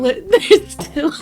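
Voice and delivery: laughing. Real, not acted. Laughing mid-sentence.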